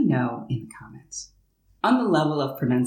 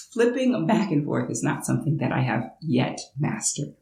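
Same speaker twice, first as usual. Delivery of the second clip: normal talking voice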